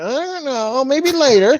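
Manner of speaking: put-on high, dismissive girlfriend brush-off; mock girlfriend voice. Mocking his girlfriend putting off plans. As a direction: toss it off high like a bored girlfriend.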